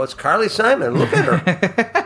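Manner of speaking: high-pitched, excited insistence with a laugh creeping in; retold starstruck disbelief, voice shooting up. Insisting after being called nuts.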